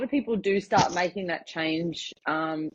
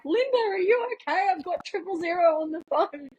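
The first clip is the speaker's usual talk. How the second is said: much higher, called-out worried question; neighbours yelling; 12 semitones higher than usual. Retold or imitated. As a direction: mimic the neighbours calling from the porch. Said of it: "yelling out to her".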